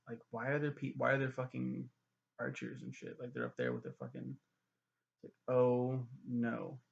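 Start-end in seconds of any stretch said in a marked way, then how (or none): none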